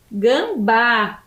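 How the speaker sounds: GAMBÁ slowly split into GAM and BÁ; syllable by syllable. Shown as a demonstration. Teacher names the second animal for pupils, syllable by syllable. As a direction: stretch GAM, then land on a long BÁ. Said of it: "dois animais que têm duas sílabas".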